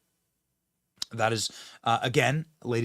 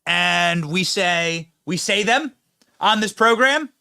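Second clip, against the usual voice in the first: loud, raised orator's declaration; mock-solemn proclamation; 7 semitones higher, 6 dB louder than usual. Host defending his right to dad jokes, grandly proclaiming.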